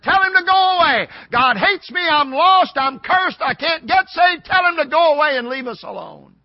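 loud, high-pitched acted yell; yelling back. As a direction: yell it back at your wife in protest. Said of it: "I yelled back at her".

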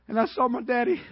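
ordinary talk

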